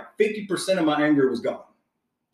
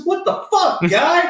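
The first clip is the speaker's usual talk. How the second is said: much higher, louder mock yell of road rage; retold yell at driver; 5 dB louder, slower than usual. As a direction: shout it up high at the mirror like you're furious. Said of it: "yelling in my rearview mirror".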